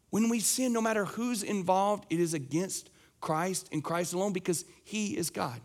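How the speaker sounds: ordinary talk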